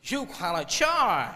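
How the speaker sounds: drawn-out, mock American accent. Managing director refuses the group, slow American accent. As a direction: stretch it with a stylised American accent. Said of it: "American accent".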